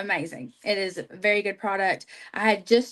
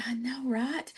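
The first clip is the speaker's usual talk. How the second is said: whisper; 3 semitones higher, 7 dB quieter than usual. Retold or imitated. Agreeing secretly about recruiting Bailey.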